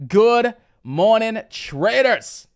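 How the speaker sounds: a big slow announcer-style welcome; stretched-out greeting. Real, not acted. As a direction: call it out like a show host. Signature loud greeting to viewers.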